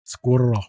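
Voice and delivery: "squirrel" with the R rolled. Shown as a demonstration. Streamer showing why it's fun to say, rolled R in "squirrel". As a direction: roll out the R in "squirrel". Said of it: "it's the rolling of the R's".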